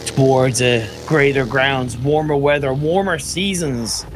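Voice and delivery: low, measured nature documentary narration; David Attenborough. Shown as a demonstration. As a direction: drop your voice and narrate migrating birds for television. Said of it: "like, a David Attenborough kind of voice".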